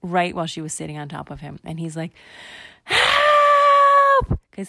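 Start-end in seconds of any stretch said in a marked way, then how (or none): 2.8-4.4 long, very high shriek; acted piercing yell, held long; cry for help. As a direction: scream it out like a panicked kid. Bar squashed and pooped on.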